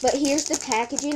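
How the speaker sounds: ordinary talk, nothing marked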